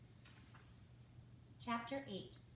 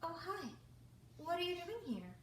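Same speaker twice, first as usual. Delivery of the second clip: surprised; 8 semitones higher than usual. A narrator's reading. Hattie surprised to see Owen.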